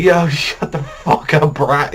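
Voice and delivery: slow, laughing thug sneer; thug Valvin voiced. Valvin brushing off the scared kid, slow laughing sneer. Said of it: "He's like".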